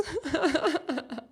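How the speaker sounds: high giggle; real, helpless giggling; laugh. Real, not acted. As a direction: burst into a run of high laughter. Laughs at her own slip.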